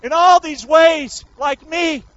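high, loud preacher's cry; full-force preaching. Real, not acted. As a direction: preach it loud, stretching the phrase endings.

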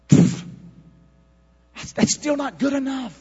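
high, loud climactic preaching; preaching at full force. Acted. Preacher to church, high climax. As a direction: build to the close.

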